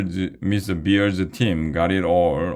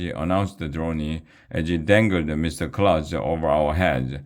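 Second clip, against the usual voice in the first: ordinary delivery, nothing marked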